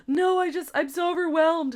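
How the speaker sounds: high-pitched mock distress; mock crying victim. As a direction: imitate a tearful, overwhelmed plea. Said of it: "like, crying and, and playing the victim".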